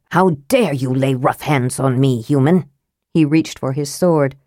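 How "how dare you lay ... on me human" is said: haughty dwarf prince. A narrator's reading. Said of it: "Sturm gave a cry of pain and outrage".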